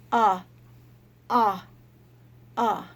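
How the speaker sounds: loud open "Ah" sounds; letter sound a. Shown as a demonstration. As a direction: call out an open "Ah", fading. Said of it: "she says".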